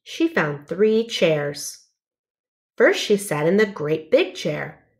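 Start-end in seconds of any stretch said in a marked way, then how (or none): none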